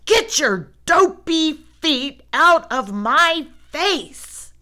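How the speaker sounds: loud, slow angry yell; angry shout. A narrator's reading. Brother yelling at sister about her feet, angry shout. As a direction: bellow it at her, fed up. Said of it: "he shouted".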